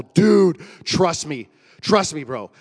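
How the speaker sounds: loud, forceful plea to a drowning friend; urgent shout. Retold or imitated. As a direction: bark it urgently over the water. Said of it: "I'm all".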